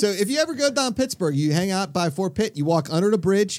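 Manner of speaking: broad, hammed-up Pittsburgh accent. Local giving visitors directions downtown, hammed-up Pittsburgh accent. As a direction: ham up the hometown Pittsburgh vowels. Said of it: "no one sounds like that".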